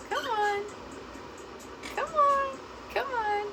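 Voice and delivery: very high sing-song coaxing; high coaxing call. Real, not acted. Owner coaxing shy birds to come over, high coaxing. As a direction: lure them over, high and sweet.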